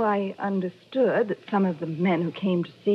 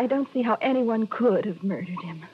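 usual speaking voice

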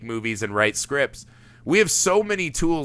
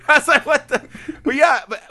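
high-pitched words through real laughter; laughing; 12 semitones higher than usual. Real, not acted. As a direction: crack up mid-sentence. Laughing at a movie moment.

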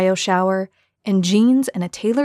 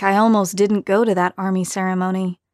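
ordinary delivery, nothing marked

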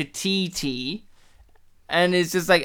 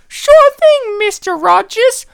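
high, loud mock secretary squeak; eager assistant in exaggerated falsetto; chirpy secretary; 10 dB louder, slower than usual. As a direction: pipe up in a high put-on voice. Acting the boss's secretary.

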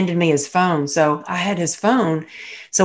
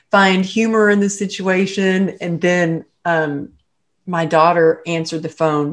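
normal talking voice

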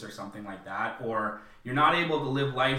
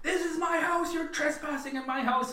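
imitated high, strained defiance; defiant standoff. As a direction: stand your ground in a high-pitched mock cry. Quotes the mother's standoff with monster.

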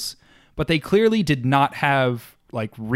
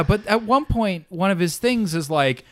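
usual speaking voice

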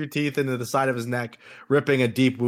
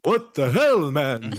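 vampire voice; 2 semitones higher, slower than usual. Acted. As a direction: ham up the vampire. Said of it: "I'm done with the voice".

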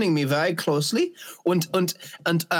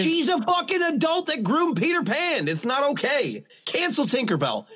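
mock outrage; 8 semitones higher than usual. Mock outrage over Tinkerbell.

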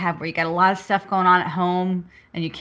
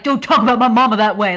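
louder mock outrage; mock-offended son; indignant retort; 6 dB louder, faster than usual. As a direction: retell his indignant protest with force. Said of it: "he's like".

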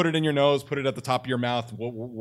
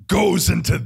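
raised, higher throaty shout; 8 semitones higher, 7 dB louder, slower than usual. Shown as a demonstration. Showing what happens when shouting, raised throaty voice. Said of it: "every time I shout".

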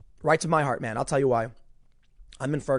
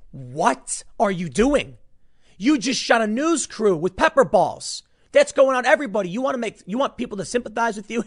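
higher, louder indignant rant; exasperated rant; 6 semitones higher, 4 dB louder than usual. Real, not acted. Scolding the cops who shot reporters, indignant rant. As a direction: blast them with disbelief. Ranting at police.

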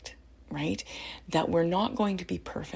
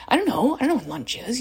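put-on loud, high, irritated snap at the kid; snippy mother brushing off a lunch question; snarky mom; 8 semitones higher, 8 dB louder than usual. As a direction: bite back like a fed-up mom. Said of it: "in a snarky voice to my kids".

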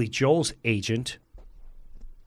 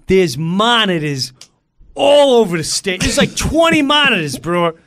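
loud, high Long Island guy; incredulous yelling, pitched way up; Tommy Burns; 10 semitones higher, 7 dB louder than usual. Retold or imitated. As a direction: shout it like a worked-up bandmate. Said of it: "Long Island guy".

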